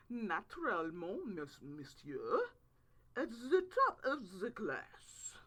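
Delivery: slow, whispered mock French aristocrat; pinky out, purr it in fake French; hammed-up French accent boasting; faux French accent. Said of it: "I said with a faux French accent".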